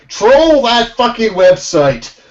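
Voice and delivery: rally the viewers; rallying call. Real, not acted.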